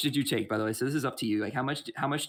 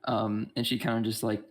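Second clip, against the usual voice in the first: normal talking voice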